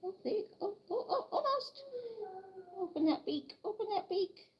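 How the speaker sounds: high, soft coaxing voice; soft coaxing pet talk. Real, not acted. Owner coaxing her chick to open its beak, high and soft. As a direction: gently wheedle a stubborn chick.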